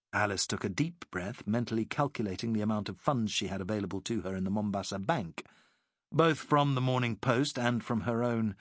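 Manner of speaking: plain reading voice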